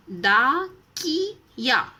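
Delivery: डाकिया spoken very slowly, each syllable clear; teacher modelling डाकिया slowly; careful pronunciation. Shown as a demonstration. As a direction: sound out डाकिया slowly for children. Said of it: "उच्चारण करके आपको शब्द पहचाना".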